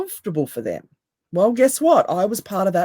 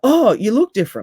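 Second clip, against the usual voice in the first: higher, louder mock surprise; imitated surprised comment; 6 semitones higher, 5 dB louder than usual. Quoting people who noticed a new look, mock surprised. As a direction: play a commenter exclaiming at the change.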